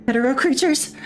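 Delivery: very high, rising question; heroine's high voice, questioning; alarmed question. Acted. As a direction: echo it back high in disbelief.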